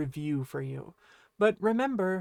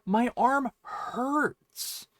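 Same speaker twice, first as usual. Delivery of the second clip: slow, higher "My arm hurts" with "hurts" stretched out; emphasis on HURTS; 6 semitones higher, much slower than usual. Shown as a demonstration. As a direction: drag out "hurts" high and plaintive. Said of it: "put emphasis on that adjective word".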